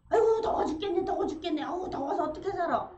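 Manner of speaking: high whiny grumble about heat; mock complaint. Shown as a demonstration. Grumbling person suffering from heat, whiny complaint.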